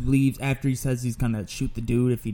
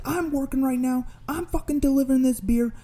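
high-pitched exasperated rant; sustained high yell, building to the end; retold yelling; 12 semitones higher than usual. Delivery man rants about being held up working, high exasperated yelling. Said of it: "So I yell".

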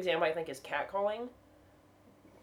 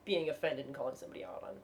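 usual speaking voice

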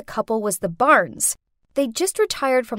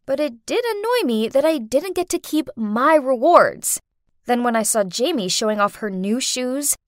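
plain reading voice

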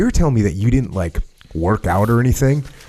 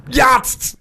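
1 high mock primal call; 13 semitones higher than usual. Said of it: "callback to primal noise".

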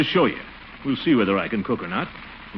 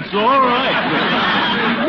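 high laughing through the words; can't stop laughing as he says it; higher and louder, laughing while conceding; 12 semitones higher, 7 dB louder, much slower than usual. Real, not acted. Laughing at Bertie's insistence.

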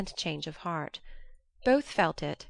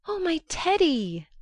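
high, louder overjoyed cry of Teddy; joyful cry; 10 semitones higher, 5 dB louder than usual. A narrator's reading. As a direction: burst out with Teddy, overwhelmed with joy. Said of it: "crying joyfully".

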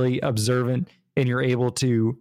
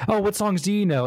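friendly rock star voice; 7 semitones higher than usual. Retold or imitated. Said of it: "he's like".

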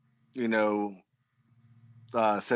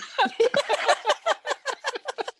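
high burst of laughter. Real, not acted. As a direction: crack up laughing. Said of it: "I didn't get a laugh in there".

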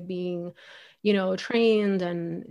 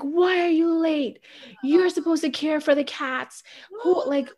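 mock angry yell; 9 semitones higher than usual. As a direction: mimic the lady yelling at you for being late. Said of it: "she's screaming at me".

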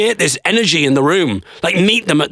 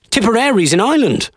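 higher-pitched comic indignation; incredulous; 7 semitones higher than usual. Real, not acted. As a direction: marvel in disbelief at the irony. Scorning the ironic song choice.

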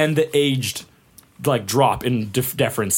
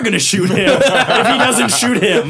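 quoting through laughter, higher and louder; laughing; 8 semitones higher, 5 dB louder than usual. Retold or imitated. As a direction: laugh through a mock threat. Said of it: "the guy behind him is like".

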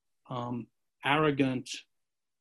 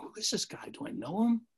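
puzzled whisper; 4 semitones higher, 6 dB quieter than usual. Retold or imitated. Puzzled thought on a train.

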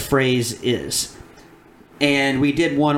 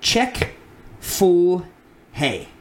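sounding out words; 3 semitones higher, much slower than usual. Shown as a demonstration. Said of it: "reading them slowly".

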